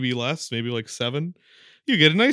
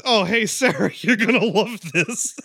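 high-pitched, breaking into laughter; hammed-up excitement, laughing as you announce it; mock gleeful friend; 5 semitones higher than usual.

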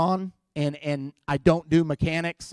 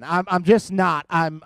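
ordinary talk, nothing marked

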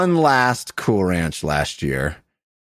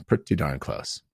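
"Pretty darn close" muttered much softer; quiet aside; 12 semitones lower, 9 dB quieter than usual. Shown as a demonstration. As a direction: repeat "Pretty darn close" under the breath.

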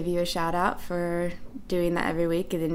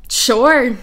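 shrugging "sure"; 5 semitones higher, 9 dB louder than usual. Retold or imitated. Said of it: "I was like".

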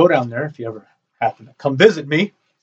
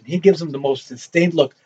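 ordinary delivery

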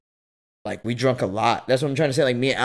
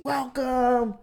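higher, softer polite staff voice; mock host voice; 11 semitones higher, 5 dB quieter than usual. Staff greeting him at the suite, soft polite mock host. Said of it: "they welcoming me".

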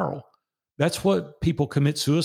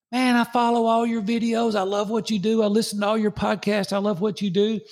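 higher, eager fan voice; gushing fan; 9 semitones higher than usual. Retold or imitated. Fan greeting him at the park, high gushing voice. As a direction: gush like a starstruck stranger. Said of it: "He went".

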